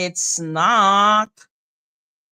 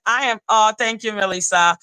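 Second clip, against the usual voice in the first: ordinary talk, nothing marked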